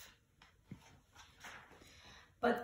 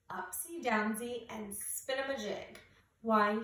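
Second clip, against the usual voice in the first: usual speaking voice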